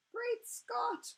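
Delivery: the Queen reading the headline in shock; crying out in shock. A narrator's reading. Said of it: "cried the famous voice".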